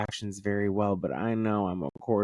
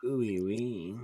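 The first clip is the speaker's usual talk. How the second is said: spooky ghost woo; 5 dB quieter, slower than usual. Acted. Spooky reaction to Ouija.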